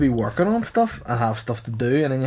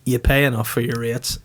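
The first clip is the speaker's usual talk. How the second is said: ordinary talk, nothing marked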